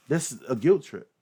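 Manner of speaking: usual speaking voice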